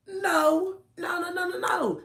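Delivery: high, loud repeated no; emphatic refusal. Retold or imitated. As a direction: shut him down with a sing-song no. Refusing to go in with son.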